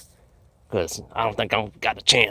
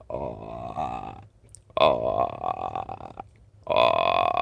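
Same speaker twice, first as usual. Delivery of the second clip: low rattling creature croak; creature noise imitation; 13 semitones lower, 4 dB quieter, much slower than usual. Hunter imitating the noise heard all night, low creature rattle. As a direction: imitate a long, very low clicking growl. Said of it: "he kept making that noise".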